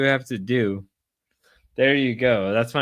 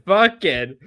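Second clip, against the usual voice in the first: usual speaking voice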